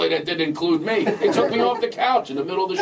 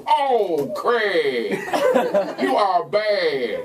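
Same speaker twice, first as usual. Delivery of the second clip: slow, drawn-out falling "Oh, Craig"; hammed-up, lingering catchphrase; co-host's catchphrase; much slower than usual. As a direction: stretch each word out in mock scolding. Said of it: "he just goes".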